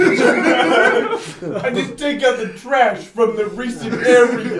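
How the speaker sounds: high, loud, laughing mob voice. Acted. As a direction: laugh through it in accent. Laughing Italian mobster joke.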